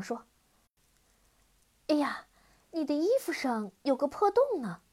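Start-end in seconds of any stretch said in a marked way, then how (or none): 1.9-4.8 mock surprise. Girl pretends alarm.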